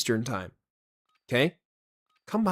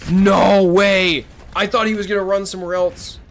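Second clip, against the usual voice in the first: high, loud disbelieving yell; shocked gasp; 8 semitones higher, 10 dB louder than usual. Real, not acted. Sudden kill in game.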